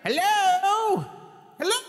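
high call up to someone above; calling from far away. Pretend.

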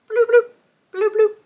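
high, quick bloops; fish bubble noises. Acted. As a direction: pop out bubbly little fish sounds.

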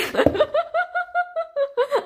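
long, high-pitched laughter; giggling fit. Real, not acted. Laughing at own mock Italian.

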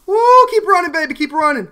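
high, loud cheering; excited whoop. Real, not acted. Gamer cheering himself on while running away, high and loud. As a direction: whoop and urge yourself on.

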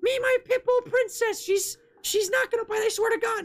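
very high falsetto lady impression; pit bull owner lady. Streamer acts meme lady defending pit bull Princess, high falsetto. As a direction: squeak it like a mock doting dog owner. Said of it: "some lady".

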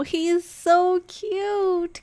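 high, loud and slow, melting over the dog; gushing. Acted. Girlfriend cooing at a passing dog, high and drawn out. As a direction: coo over how cute he is.

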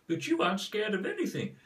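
put-on squeaky young cub answering his father; Simba voice. Shown as a demonstration. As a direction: pipe up like a little lion cub. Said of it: "That was Simba".

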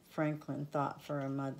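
usual speaking voice

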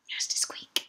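soft whispered insistence; whispered, conspiratorial. Real, not acted. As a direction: hush it like a secret rule. Whispered emphasis.

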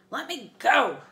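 loud desperate yell; furious cry, pulling free; shouting. Acted. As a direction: struggle and shout to be let go. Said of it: "Clarice pummeled my hand".

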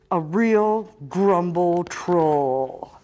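loud, drawn-out storytelling; slow, booming emphasis. A narrator's reading. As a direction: stretch each word out loudly. Stressed, drawn-out story line.

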